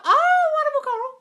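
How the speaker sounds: high, loud "oh wonderful"; squealed praise; delighted squeal. Real, not acted. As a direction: gush with delight. Reacting to a viewer's comment.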